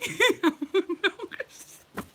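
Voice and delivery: loud high laugh; real laughter. Real, not acted. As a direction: burst out laughing. Laughs at repeat.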